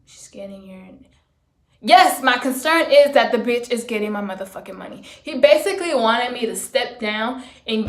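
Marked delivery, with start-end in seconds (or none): 1.8-5.0 fast, loud, heated comeback; angry retort. Retold or imitated. Answering the boss back, loud and heated. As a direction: snap back the answer, fed up.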